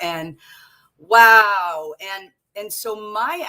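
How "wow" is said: a sudden, much louder wow. Real, not acted. Guest recalling the emotional rollercoaster, loud wow. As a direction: let the wow burst out.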